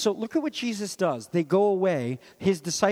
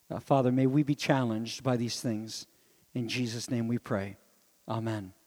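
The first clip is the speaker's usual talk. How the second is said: prayer; 4 semitones lower than usual. Acted. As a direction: pray it gently to the Father. Closing prayer.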